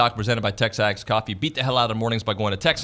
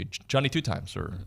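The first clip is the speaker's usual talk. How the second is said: normal talking voice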